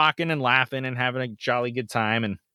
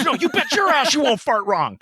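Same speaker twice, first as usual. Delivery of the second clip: correcting cop; 11 semitones higher than usual. Retold or imitated. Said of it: "And so he goes".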